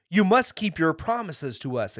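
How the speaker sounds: pressing the point with a lifted voice; exclaiming. A narrator's reading. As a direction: demand it firmly and loudly. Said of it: "exclaimed Dorothy".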